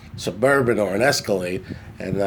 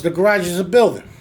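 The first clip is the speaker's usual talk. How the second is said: raised, louder insistent uncle voice; hammed-up uncle protesting, pitched up; imitating uncle; 7 semitones higher, 4 dB louder than usual. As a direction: play the stubborn uncle, insisting over and over. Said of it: "He goes".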